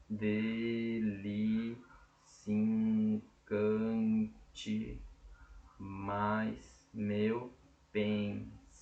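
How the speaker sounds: slow, broken into pieces with long pauses; chunked for counting. Shown as a demonstration. Teacher splitting the verse so students can count it. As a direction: pause between "Dele", "se encante", "mais meu", "pensamento". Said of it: "Vamos contar?".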